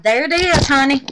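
high, loud delighted reveal; there it is, honey, sung out in delight; thrilled. Real, not acted. Showing viewers her soap, thrilled.

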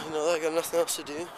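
normal talking voice

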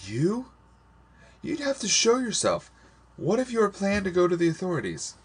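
high-pitched, agitated disbelief; alarmed, accusing high voice; agitated challenge. A narrator's reading. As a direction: question sharply, pitch way up. Condemned girl challenges her friend.

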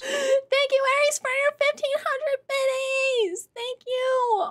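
gasp, then high, gushing gratitude; delighted thanks. Real, not acted. Streamer thanks a donor for a big gift, thrilled.

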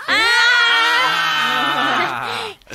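put-on drunken relieved shout; loud drunken groan. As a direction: let out a big drunk groan.

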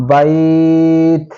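বাইত with the ai stretched one alif long; slow stretched বাইত; drawn-out bait. Shown as a demonstration. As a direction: hold the vowel in বাইত for a full alif count. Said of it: "এক আলিফ টানিয়ে পড়িতে হবে".

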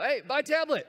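high, loud blurt; crazy shopper's shout. Acted. Shopper walking into Best Buy shouting a search query, crazy loud shout. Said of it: "like a crazy person".

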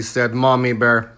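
usual speaking voice

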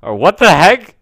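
high, loud startled exclamation; amused disbelief. Real, not acted. Player seeing broken textures, loud amused shock. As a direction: blurt it out, about to laugh.

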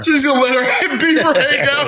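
high, laughing through it; can't keep the laugh out. Real, not acted. Laughing at the joke.

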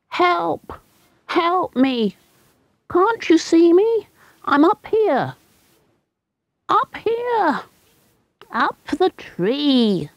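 high, desperate calls from up a tree; boy shouting for help. Acted. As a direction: call out high like a frightened boy stuck in a tree. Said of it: "he heard someone shouting so loudly".

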